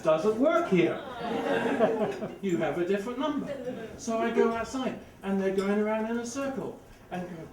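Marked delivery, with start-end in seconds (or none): 0.0-3.5 phone message voice. Retold or imitated. Phone voice says wrong number.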